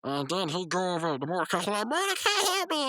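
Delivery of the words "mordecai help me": high-pitched cartoon cry for help; panicked plea. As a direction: plead in hammed-up terror. Rigby begs Mordecai for help.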